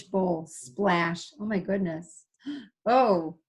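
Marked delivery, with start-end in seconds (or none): none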